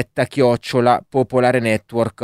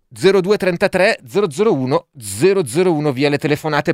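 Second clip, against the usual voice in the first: radio announcer; 6 semitones higher than usual. Acted. Radio host announces phone line.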